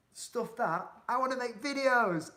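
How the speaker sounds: loud, high, defiant outburst; emphatic declaration. Retold or imitated. Telling viewers why he left his trade, emphatic and loud. Retold bold career decision, voice raised.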